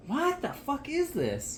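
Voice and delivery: surprised. Real, not acted. Reading a strange ingredient list.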